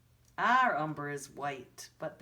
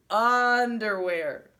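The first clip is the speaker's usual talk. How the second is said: "Underwear" drawn out slowly and loud; slow stretched word; 3 semitones higher, 9 dB louder, much slower than usual. Shown as a demonstration. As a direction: savor the silly word. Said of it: "That is so funny".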